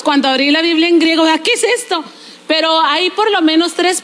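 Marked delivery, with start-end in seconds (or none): 1.4-2.0 baffled reaction. Retold or imitated. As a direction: replay your puzzlement, pitched up. Retells bafflement at Greek script. (desconcierto fingido)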